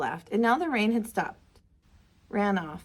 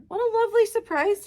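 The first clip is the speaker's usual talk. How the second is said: grandpa's happy greeting; 11 semitones higher than usual. Acted. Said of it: "he said".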